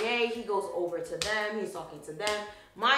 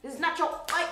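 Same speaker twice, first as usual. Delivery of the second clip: higher, louder sassy clapback; retold sassy rebuke; indignant telling-off; 6 semitones higher, 5 dB louder than usual. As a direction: put him in his place. Acted telling-off of clingy man.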